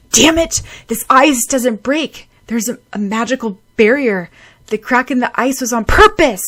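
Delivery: loud, high frantic cursing; frustrated shout. Acted. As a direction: swear at the ice that won't break. Frantic at the barrier.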